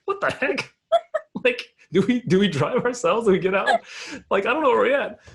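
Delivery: high-pitched, half-laughing disbelief; bewildered panic. Retold or imitated. Retold bewilderment at abandoned car.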